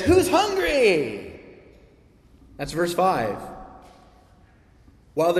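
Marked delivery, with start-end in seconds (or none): 0.0-1.2 high, slow, booming host's question; God's voice. Acted. God offering food to his children, slow and grand. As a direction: call out like a generous host at a feast. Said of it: "God looks on his children and he says".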